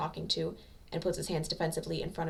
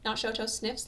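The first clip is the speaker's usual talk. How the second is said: ordinary delivery, nothing marked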